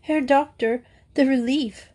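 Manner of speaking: high-pitched, mildly tearful; a touch of weepy relief; sobbed relief. A narrator's reading. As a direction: let relief spill out in a lightly acted sob. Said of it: "sobbed Madge".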